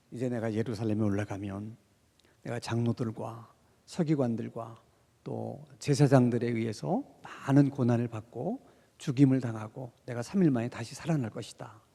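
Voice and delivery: softly voiced Jesus foretelling his death; quiet Jesus voice. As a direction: speak it low, heavy and gentle. Teacher voiced telling his death.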